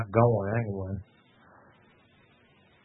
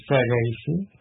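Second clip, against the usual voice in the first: ordinary delivery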